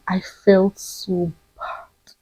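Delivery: whispered, slow, near tears; real emotion, barely voiced; emotional whisper. Real, not acted. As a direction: let the feeling choke it down to a whisper. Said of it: "I'm getting emotional again".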